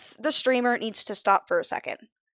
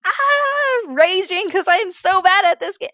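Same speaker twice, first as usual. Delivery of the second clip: much higher, loud mock frustrated cry; playful hammed-up rage, much higher pitch; mock raging; 10 semitones higher, 4 dB louder than usual. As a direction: act out a gamer raging at the game. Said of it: "or when they're".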